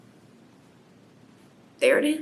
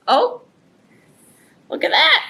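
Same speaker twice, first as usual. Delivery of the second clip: ordinary delivery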